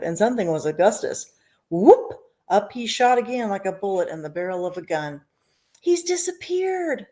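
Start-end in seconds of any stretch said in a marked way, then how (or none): 5.8-7.1 high, slow panicked cry; mother yelling. A narrator's reading. Mother yelling as her son vanishes up the pipe. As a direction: wail it in alarm. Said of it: "yelled Missus Gloop".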